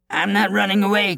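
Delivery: high-pitched coward's cry. A narrator's reading. Coward makes excuses to the giant, high-pitched cry. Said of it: "cried the coward".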